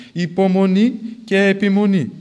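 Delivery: proclaiming Υπομονή και επιμονή with force; emphatic answer. Retold or imitated. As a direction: drive home υπομονή and επιμονή. The nun answers Father Ioakim.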